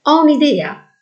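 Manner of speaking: bright ringing cry, pitched high and loud; boy bursting out with a sudden idea; ringing exclamation. A narrator's reading. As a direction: blurt the idea out in a clear ringing voice. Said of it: "con voce squillante, Rick esclamò".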